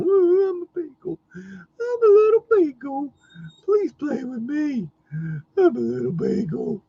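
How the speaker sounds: very high, whimpering baby voice mocking the dog; whiny beagle. Mocking the whining beagle to his face: very high whimpering baby voice. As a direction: whine it like a needy little puppy.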